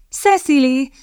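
high, bright calling of a name; sunny, high-pitched hail; cheerful call. A narrator's reading. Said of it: "kiáltotta derűsen".

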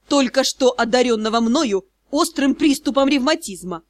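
plain reading voice

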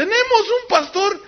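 sneering preacher calls him out; sarcastic mockery. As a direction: ridicule the pastor publicly. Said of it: "así burlándose sarcásticamente".

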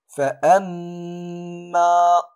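FA-AMMA with the mm held long as a steady hum; nasal ghunna. Shown as a demonstration. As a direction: hum through the doubled m in فأما for two counts. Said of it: "تقرأ بغنة مقدارها حركتان".

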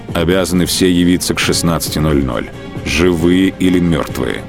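normal talking voice

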